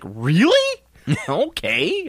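high, laughing disbelief; surprised \"Really?\", laughing it off. Retold or imitated. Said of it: "And I was like".

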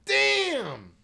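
high, drawn-out loud exclamation; big surprised whoop; real amazement. Real, not acted. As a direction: let it ring out, astonished. Amazed at the time.

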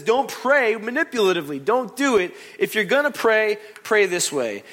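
high, loud urgent preaching. Acted. Pastor quoting Jesus to the congregation, loud preaching. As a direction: drive the command home.

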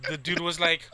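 normal talking voice

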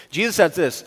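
ordinary delivery

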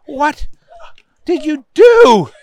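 high, halting question, rising in volume; aghast judge. A narrator's reading. Judge looking down at Booger, high and aghast. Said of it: "The judge looked down".

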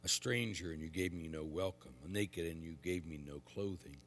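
measured, solemn proclamation; quiet liturgical reading; gospel reading. Acted. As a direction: read scripture aloud at the altar.

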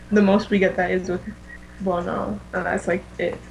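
usual speaking voice

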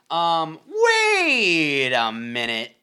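long, slow, loud "wait a minute"; drawn-out wait a minute. Pretend. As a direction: stretch out "wait a minute" slowly and loudly. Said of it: "He did a good wait a minute".

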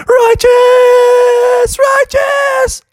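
very high, loud drawn-out shriek; cartoon daredevil's triumphant yell; exultant cheer. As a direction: whoop it out in a high squeal. Bubby exults on the trampoline.

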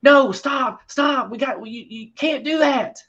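high, frantic pleading cry; panicked protest. Acted. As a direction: yell it in panic from the back. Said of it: "you hear Feder in the background".

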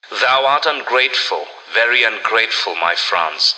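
ordinary delivery

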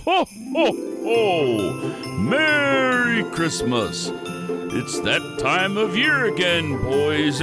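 booming high jolly Santa; big hearty ho ho ho. Acted. Santa appears.

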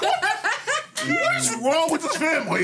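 high-pitched incredulous outburst; mock disbelief. Daughter recounting road-trip warnings about dad, mock disbelief. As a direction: cry out in exaggerated bewilderment. Reacting in a retold family story.

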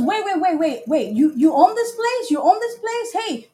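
high, eager mock surprise; imitating his backpedal. Date learns she owns the lounge, high eager mock plea. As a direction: squeak it out like he just realized she owns it. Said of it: "he goes".

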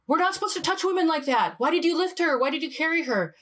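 high indignant reproach; young monk scolding his elder; younger monk. Retold or imitated. Younger monk scolding the older monk, high and indignant. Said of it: "the younger monk turns to him and says".

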